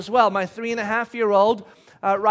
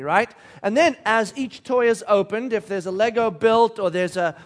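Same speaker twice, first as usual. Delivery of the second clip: ordinary talk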